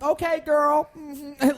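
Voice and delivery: high, loud mock sassy "Okay, girl". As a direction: imitate a friend's put-on sassy voice.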